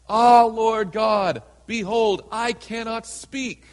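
slow, loud, pleading cry of Ah, Lord God; loud pleading protest. Acted. Jeremiah protesting to the Lord, loud and pleading. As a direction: wail the excuse like a frightened young prophet.

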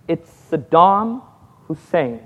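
slow, grave reveal, dropping at the end; gravely measured pronouncement; solemn. Retold or imitated. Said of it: "I said it very solemnly".

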